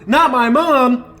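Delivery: loud, high, slow shouted plea; terrified shout for her mom; desperate shout. Acted. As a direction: scream it as she runs. Panicked shout for mother.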